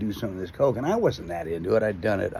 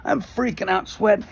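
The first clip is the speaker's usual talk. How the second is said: high-pitched panicked outburst; hammed-up rising panic; acted freaking out; 11 semitones higher, 3 dB louder than usual. Passenger reacts to friend's crash dream, high mock panic. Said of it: "I'm just fucking freaking out".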